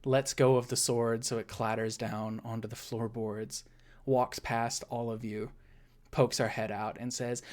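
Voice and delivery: normal talking voice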